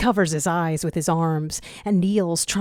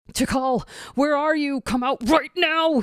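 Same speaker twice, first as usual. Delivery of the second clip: higher urgent calling out; desperate command; 9 semitones higher than usual. A narrator's reading. Zero searching the jungle for Tikal, desperate higher command. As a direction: call for her, demanding. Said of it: "he commands".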